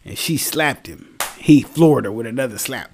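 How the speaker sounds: ordinary delivery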